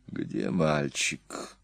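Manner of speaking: very slow, low and soft; imperturbable calm. Acted. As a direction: ask coldly, utterly unmoved. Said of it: "невозмутимо спросил Аттила".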